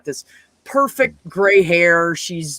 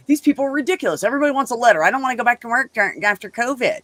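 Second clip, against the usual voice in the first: higher-pitched retold rant; imitated fed-up griping, pitched up; pissed-off boss, imitated; 6 semitones higher than usual. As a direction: rant like an exasperated boss, retelling it. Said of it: "So she's pissed about it".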